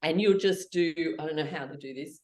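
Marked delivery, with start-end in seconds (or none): none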